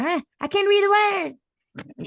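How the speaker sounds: high, slow, stretched-out mock wail; exaggerated high-pitched impersonation of a lost singer; mocking singer's complaint. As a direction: whine it high, mimicking a flustered performer. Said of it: "got your ass up there talking about".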